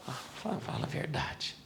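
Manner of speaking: preacher whispering to the congregation, confiding; whispered aside. Real, not acted. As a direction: lean in and whisper it. Aside to audience.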